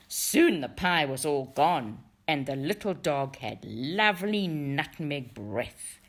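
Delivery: usual speaking voice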